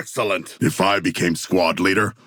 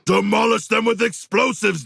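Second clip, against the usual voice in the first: shouted order; 13 semitones higher than usual. Acted. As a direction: bark the order to blow them up. Ordering squadmate in combat.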